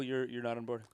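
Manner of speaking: normal talking voice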